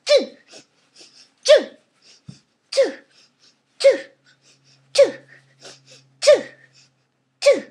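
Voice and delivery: sharp loud hiccup bursts on "Choo!"; short explosive hiccups. Real, not acted. Caught by the hiccups in the middle of a voice lesson, loud hiccups. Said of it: "I've never heard you with the hiccups".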